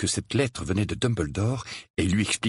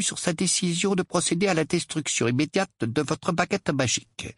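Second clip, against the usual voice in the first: higher-pitched, formal officialese; official letter reading; 6 semitones higher than usual. A narrator's reading. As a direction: read it out as a stiff ministry letter. (lecture guindée d'une lettre officielle)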